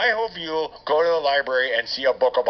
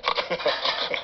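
snorting laugh; 4 dB quieter than usual. Acted. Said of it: "Oh, I snorted again".

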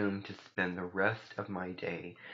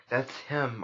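higher, louder exclamation; raised call of recognition; crying out; 5 semitones higher, 6 dB louder than usual. A narrator's reading. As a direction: cry out on spotting him. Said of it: "I cried".

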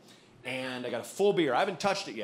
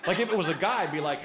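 ordinary delivery

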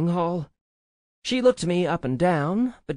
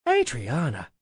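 impressed, emphatic character voice; louder, swooping exclamation after the name; gasped amazement; 4 dB louder than usual. A narrator's reading. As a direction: exclaim in delighted astonishment. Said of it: "Evelyn gasped".

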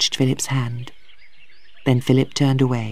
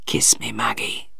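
faint, breath-only farewell; dying whisper; 8 dB quieter than usual. A narrator's reading. Dying father asks his daughter's kiss, whispering. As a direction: whisper with little strength left.

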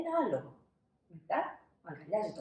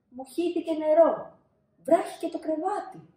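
put-on child voice; 8 semitones higher, 7 dB louder than usual. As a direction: play the upset little boy. Child reports spilled water. (ψηλή παιδική φωνή)

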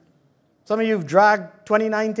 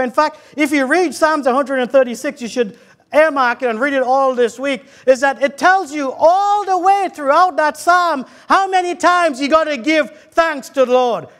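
rhythmic, raised preacher's cadence; fervent preaching; 4 semitones higher, 4 dB louder than usual. Acted. Preacher calling the church to thank God, preaching fervently. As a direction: proclaim it as praise, phrase by phrase.

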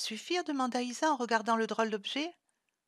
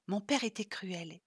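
plain reading voice

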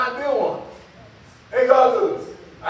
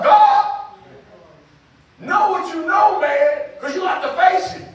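higher, louder urgent sermon delivery; full-force preaching; 6 semitones higher, 4 dB louder, slower than usual. Real, not acted. Preacher warning the congregation to face God, forceful shout. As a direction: preach it out hard, voice lifted.

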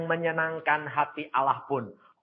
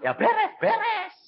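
higher, hurried-sounding mock urging; impatient; 3 dB louder than usual. Preacher retells urging God to hurry, higher and playfully impatient. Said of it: "paling nggak sabar".

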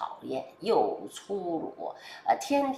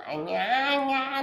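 a light, slow, drawn-out mock of his exclamation, pitched higher; imitating Trump; 8 semitones higher, much slower than usual. Commentator imitating how people copy Trump's catchphrase, drawn-out and higher. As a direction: stretch the sigh out like a fan copying Trump. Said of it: "好多人不是还学他吗".

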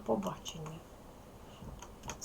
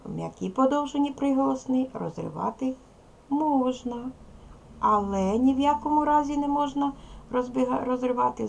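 emphatic rule; 5 semitones higher, 5 dB louder than usual. Shown as a demonstration. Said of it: "Правило".

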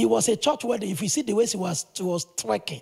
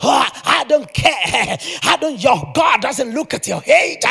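loud, slow mocking shout of a hyped preacher; 6 dB louder than usual. Mocking the crowd's hype preacher, loud and stretched. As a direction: ham up a preacher working the crowd. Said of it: "some people say".

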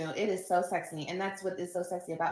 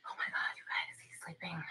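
hushed whisper, much softer; 3 semitones lower, 8 dB quieter than usual. Real, not acted. Whispering beside sleeping baby.

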